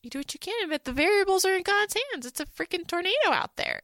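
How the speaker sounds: a hint of a pious dad declaring doom; Mister Whitaker. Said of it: "And Mister Whitaker's like".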